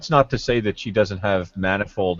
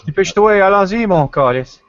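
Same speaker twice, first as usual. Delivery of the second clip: high, loud hammed-up French accent; fake Quebec French; 10 semitones higher, 6 dB louder than usual. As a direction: rattle off Quebec slang. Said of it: "that's not even French".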